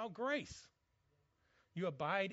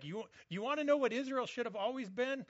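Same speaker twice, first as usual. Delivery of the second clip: excited; 7 semitones higher, 7 dB louder than usual. Retold or imitated. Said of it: "He's, he's like".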